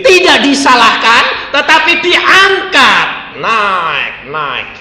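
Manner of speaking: loud, high, slowing on key words; forceful preaching. Acted. Preacher exhorting the congregation loudly. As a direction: proclaim it with full conviction.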